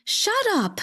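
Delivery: mild narrated scream, pitched high; sharp high outburst without much volume; screamed command. A narrator's reading. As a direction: cut them off in a sudden high pitch. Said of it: "I screamed".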